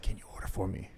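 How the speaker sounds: very soft, low, secretive request; imitated whisper. Tripping customer asks friend to order, imitated whisper. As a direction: ask your friend under your breath. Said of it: "like, whisper to your friend".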